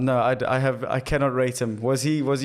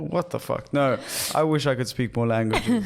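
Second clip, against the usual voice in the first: ordinary talk, nothing marked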